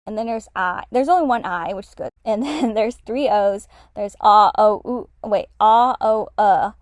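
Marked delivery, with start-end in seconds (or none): 3.9-6.7 vowels "ah", "oh", "ooh" sounded out one by one; careful vowel-by-vowel demo; Vietnamese vowel demo. Shown as a demonstration. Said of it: "this is like the short O".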